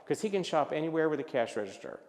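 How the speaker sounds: ordinary talk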